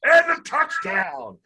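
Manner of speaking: high, loud, slow mock touchdown call; imitating a broadcaster's touchdown scream; screaming announcer. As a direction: stretch it out like a play-by-play man losing it. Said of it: "he starts screaming".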